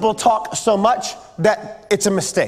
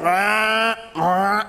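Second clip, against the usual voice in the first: drawn-out guttural lizard "rah"; lizard noises; 3 semitones lower than usual. Pretend. Preacher mimics first lizard people for the youth, guttural grunts. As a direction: make dumb prehistoric lizard sounds. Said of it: "all the lizard people were just going".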